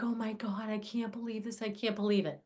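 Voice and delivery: mortified hush under your breath. Retold or imitated. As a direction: murmur it in quiet shock. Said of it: "I was like".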